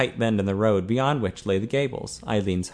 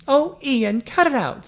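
high, louder woman's rebuke; exasperated Eileen; 4 dB louder, slower than usual. A narrator's reading. Eileen telling Ian to stop boasting, exasperated. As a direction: tell him off in a raised pitch.

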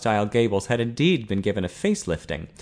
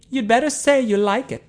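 high, louder woman's caution; warning Eileen; 12 semitones higher, 5 dB louder than usual. A narrator's reading. Eileen warning Ted to praise Ian's work, cautioning. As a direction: warn him in a much raised pitch. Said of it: "Eileen warned".

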